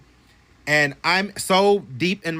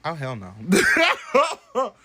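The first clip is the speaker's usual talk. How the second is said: slow, spooked laugh; 3 semitones higher, much slower than usual. Real, not acted. Telling the ghost story, spooked laugh. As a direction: shaken by her own story. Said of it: "even talking about this shit scaring me".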